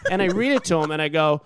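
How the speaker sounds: ordinary talk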